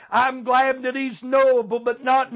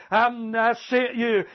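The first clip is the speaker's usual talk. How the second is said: normal talking voice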